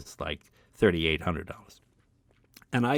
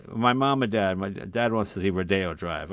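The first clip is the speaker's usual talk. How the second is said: usual speaking voice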